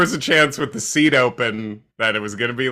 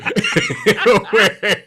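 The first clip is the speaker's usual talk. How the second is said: laugh; 9 semitones higher, 5 dB louder than usual. Real, not acted. Laughs at the concession.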